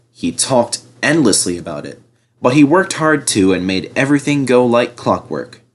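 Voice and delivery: usual speaking voice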